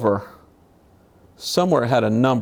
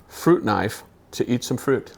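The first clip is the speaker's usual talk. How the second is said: ordinary talk, nothing marked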